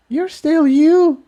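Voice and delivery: reassuring. Acted. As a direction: comfort him, slowly. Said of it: "she assured him".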